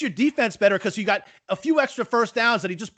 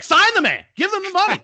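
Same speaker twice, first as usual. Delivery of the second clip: high, loud pleading fan voice; excited fan demand; 12 semitones higher, 5 dB louder than usual. Pretend. Imagining a Jets fan's excited plea to sign him. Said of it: "You'd be like".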